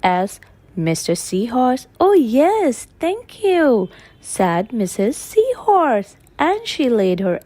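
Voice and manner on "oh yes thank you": lifted, bright female character; high character voice. Acted. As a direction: voice her high and pleased. Mrs Seahorse voiced, high.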